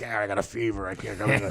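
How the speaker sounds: low, soft mock-sick voice; imitating sick call-in. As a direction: feebly fake a fever on the phone. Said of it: "I would be in withdrawals".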